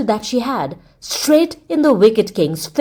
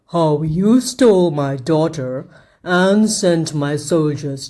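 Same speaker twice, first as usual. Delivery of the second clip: lower, slow, menacing king voice; a cruel king savouring his threat, slowly; wicked king; 4 semitones lower than usual. A narrator's reading. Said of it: "the wicked king went on".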